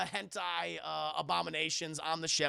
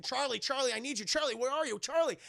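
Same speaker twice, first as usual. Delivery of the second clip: higher, louder calling for Charlie; urgent call, a bit higher and louder; 5 semitones higher, 4 dB louder than usual. Retold or imitated. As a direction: shout into the dark for Charlie. Calling out for missing dog.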